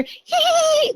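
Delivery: a quick eerie little giggle; ghostly giggle. Retold or imitated. Mother imitating the unseen child's giggle in the kitchen: high giggle. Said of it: "like, giggling".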